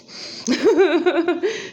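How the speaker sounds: high burst of real laughter; amused laugh. Real, not acted. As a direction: laugh at your own impression. Laughing at own impression.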